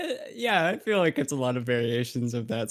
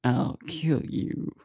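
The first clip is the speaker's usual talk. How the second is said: menacing villain line; slower than usual. Retold or imitated. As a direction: deliver a slow menacing threat. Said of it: "do your best impression of a line the other says".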